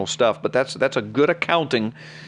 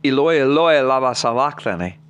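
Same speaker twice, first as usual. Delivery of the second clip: crying out, raised and strained in an ancient tongue; a loud anguished cry in Aramaic; 4 dB louder than usual. Acted. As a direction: call out to heaven in anguish. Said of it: "Jesus cried out in a loud voice".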